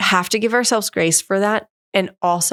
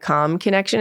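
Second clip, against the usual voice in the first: normal talking voice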